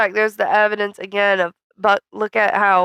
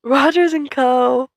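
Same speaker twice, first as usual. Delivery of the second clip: higher, drawn-out mock echo; playful echo; 5 semitones higher, 3 dB louder, slower than usual. Echoing the co-host's phrase, playfully drawn out. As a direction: stretch it out teasingly.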